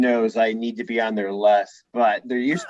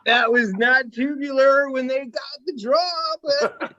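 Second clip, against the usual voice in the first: higher-pitched, drawn-out surfer-bro parody; hammed-up Valley Girl meets stoner surfer, rising into laughter; eighties surfer dude; 5 semitones higher than usual. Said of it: "it's like eighties surfer or, like, Valley Girl speak plus, like, gangster rap talk".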